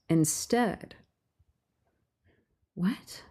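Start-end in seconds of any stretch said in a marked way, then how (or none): 2.7-3.2 breathy hushed What?; a whispered stunned what; whispered disbelief. Pretend. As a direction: gasp it out under your breath in mock shock. Mock disbelief at the story.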